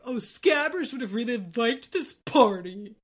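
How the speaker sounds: hammed-up grieving pet owner; mock-mournful, put-on moan; sulky character impression. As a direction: draw it out, laying on the guilt. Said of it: "Ron's over there like".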